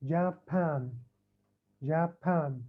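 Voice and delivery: "جابان" softly, in two separate syllables; split into two parts. Shown as a demonstration. Teacher repeating the divided word for students, split in two. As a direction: say "جابان" with a small break after جا. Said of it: "كأنه قرأتها على قسمين".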